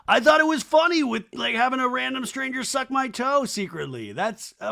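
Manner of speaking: high, loud defensive mimic; mock indignant bro; mocking Jesse Solomon. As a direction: whine it like a defensive guy. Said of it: "Jesse Solomon was like".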